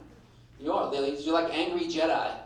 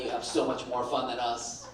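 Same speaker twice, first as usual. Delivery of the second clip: normal talking voice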